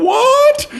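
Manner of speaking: high, loud "What?" breaking into a laugh; laughing disbelief. Retold or imitated. Boss learning of the stolen computers, disbelieving laugh.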